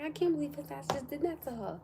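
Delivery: high-pitched, indignant disbelief; retold outraged disbelief. Her own outraged reaction, retold.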